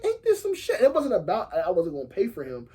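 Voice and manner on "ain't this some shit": high, fed-up "can you believe this"; exasperated disbelief. Retold or imitated. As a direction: toss it up high, done with it all. Cop threatens jail over lap dance.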